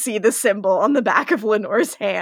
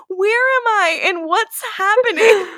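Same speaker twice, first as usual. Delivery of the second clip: put-on confused child; 11 semitones higher than usual. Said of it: "poor child".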